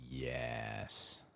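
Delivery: usual speaking voice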